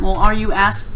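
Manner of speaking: ordinary talk, nothing marked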